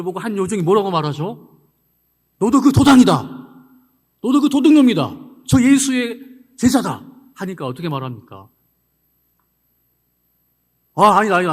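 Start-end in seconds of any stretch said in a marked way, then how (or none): 2.4-7.0 loud, high accusing shout; accusing servant girl. Retold or imitated. Servant girl loudly accusing Peter by the fire. 10.9-11.5 loud flustered denial. Retold or imitated. Peter denying Jesus.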